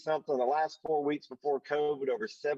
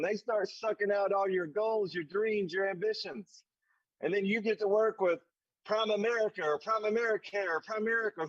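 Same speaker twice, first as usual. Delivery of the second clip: higher, rising exhortation like a sermon; building preacher fervor; 5 semitones higher than usual. Acted. Rallying the team leaders against the enemy, in a fervent preaching voice. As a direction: preach it to the leaders with growing fire.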